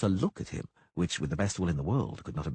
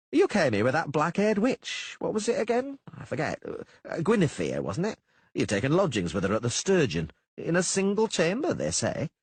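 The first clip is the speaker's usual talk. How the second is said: mayor voice; 9 semitones higher than usual. Acted. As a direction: bluster like a small-town mayor.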